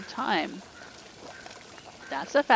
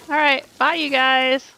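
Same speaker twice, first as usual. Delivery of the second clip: loud playful goodbye; 4 semitones higher, 7 dB louder than usual. Real, not acted. Calling goodbye to the characters.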